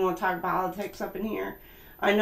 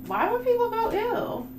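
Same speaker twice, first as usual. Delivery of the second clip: high squeaky mock whine, much higher; 13 semitones higher than usual. A reader on stream asking why people go ew, in a squeaky high voice.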